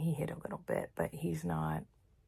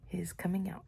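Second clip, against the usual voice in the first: ordinary talk, nothing marked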